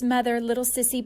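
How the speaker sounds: ordinary delivery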